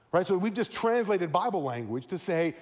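usual speaking voice

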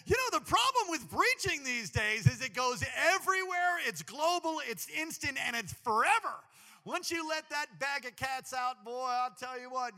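high, loud, through laughter; helpless high-pitched amusement; real laughing. Real, not acted. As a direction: crack up while trying to preach. Cracking up at own mishap.

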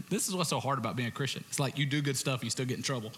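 normal talking voice

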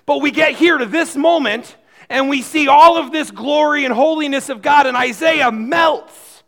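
loud, rising sermon climax; preaching at full force. Acted. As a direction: drive it home from the pulpit.

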